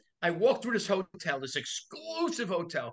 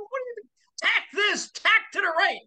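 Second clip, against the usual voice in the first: panicked confusion. Retold or imitated. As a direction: yell in a panic on the sailboat. Panicked sailor, confused by orders.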